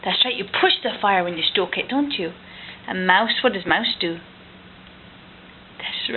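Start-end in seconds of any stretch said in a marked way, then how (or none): none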